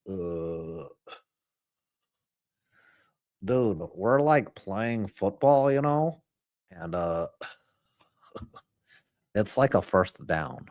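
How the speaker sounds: low, slow, dopey cartoon teen; Butthead. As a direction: drop low with long grunted "uh"s and slack pacing. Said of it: "Those are my only imitation".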